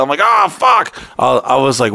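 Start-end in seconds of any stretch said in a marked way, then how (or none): 0.2-0.9 high, shouted "oh fuck"; retold cry of frustration; exasperated outburst. Guy retelling a failed payment, exasperated shout.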